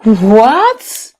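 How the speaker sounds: shocked what. Real, not acted. Reactor hearing the sister lost money, bursting out in loud shock. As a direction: caught off guard by the news.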